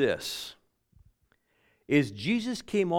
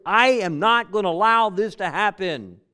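raised, louder emphatic declaration; emphatic refusal; 6 semitones higher, 7 dB louder than usual. Retold or imitated. John refusing to let this happen, emphatic. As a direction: dig in and refuse outright. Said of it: "in an emphatic way".

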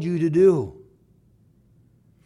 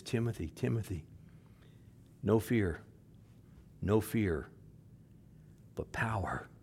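soft, tender, softer urging to Timothy; gentle reassurance; 5 semitones lower, 13 dB quieter than usual. Acted. Paul gently reassuring young Timothy, soft and tender. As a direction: lay it on him gently, like a mentor. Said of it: "Paul writes to Timothy, he says".

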